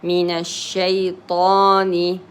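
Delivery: من الشيطان with a long aa after the ta; two-count alef. Shown as a demonstration. Teacher gives the alef two periods, prolonging من الشيطان. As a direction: draw out the last aa of من الشيطان. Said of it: "you give it two periods".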